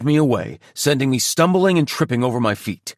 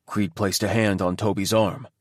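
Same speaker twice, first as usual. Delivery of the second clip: ordinary delivery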